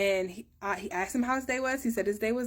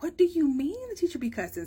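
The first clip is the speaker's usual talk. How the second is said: incredulous question; 8 semitones higher, 3 dB louder than usual. Retold or imitated. Mom questioning son.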